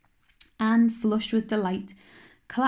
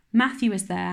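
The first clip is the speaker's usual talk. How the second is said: ordinary delivery, nothing marked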